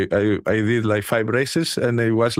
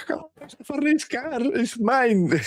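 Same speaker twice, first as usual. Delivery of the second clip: giddy excited; 11 semitones higher, 3 dB quieter than usual. Retold or imitated. Retelling his giddy moment with the car.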